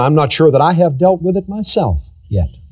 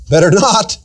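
ordinary talk

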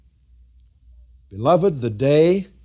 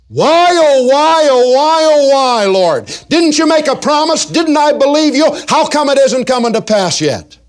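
high, loud anguished crying out to God; desperate plea; 13 semitones higher, 6 dB louder than usual. Acted. Believer crying out to God over a broken promise, loud anguished plea. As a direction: wail it up to heaven, pleading.

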